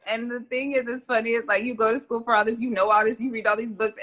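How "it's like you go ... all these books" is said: usual speaking voice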